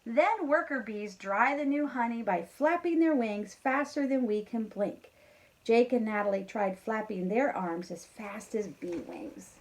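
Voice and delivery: lively storybook read-aloud; reading to children. A narrator's reading. Teacher reading a farm story to kindergarteners, lively. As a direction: read it brightly to a class of kids. Reads picture book aloud.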